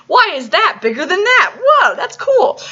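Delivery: high, drawn-out amazed whoa; amazed viewer. Retold or imitated. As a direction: act out a viewer wowed by the art. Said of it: "'cause you're like".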